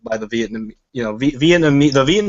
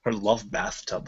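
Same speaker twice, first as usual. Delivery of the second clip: slow, lower, much softer mock-poetic recital; bad poet; 5 semitones lower, 11 dB quieter, slower than usual. Repeats his own phrase to friends as mock poetry, slow and hushed. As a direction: savour it like overwrought verse. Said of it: "You're like a bad poet".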